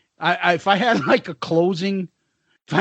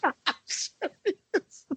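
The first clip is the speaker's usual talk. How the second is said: laughing; 8 semitones higher, 9 dB quieter, much slower than usual. Real, not acted. Laughing through the reply.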